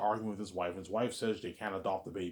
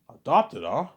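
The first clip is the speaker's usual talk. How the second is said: loud, raised, doubting echo; sceptical echo; 5 semitones higher, 10 dB louder, slower than usual. Retold or imitated. Cop doubting that the kid is adopted, sceptical echo. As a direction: throw the word back as a sharp query.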